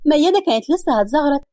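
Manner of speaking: usual speaking voice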